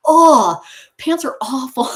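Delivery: a loud, high, exaggerated "Oh" of disgust; mock disgust. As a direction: ham up your horror at putting on pants. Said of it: "I was like".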